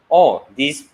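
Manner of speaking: normal talking voice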